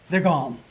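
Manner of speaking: mock slumping sigh of relief; exaggerated sigh; relieved sigh. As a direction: imitate a speaker deflating. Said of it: "they go like".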